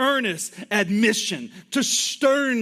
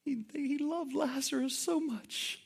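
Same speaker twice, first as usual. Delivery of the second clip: softer and higher, mock-sentimental; mocking onlookers; 5 semitones higher, 11 dB quieter than usual. Bystanders misreading Jesus' tears, mock-sentimental and soft. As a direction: imitate gushing bystanders, soft and high. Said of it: "And they say".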